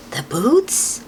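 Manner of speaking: ordinary delivery, nothing marked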